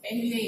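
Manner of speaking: पहले as 'peh-le', first vowel an 'e'; wrong pronunciation. Shown as a demonstration. Teacher repeating the students' mistake, wrong pronunciation. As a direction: say पहले with 'eh' after the p. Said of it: "ये भी गलत है".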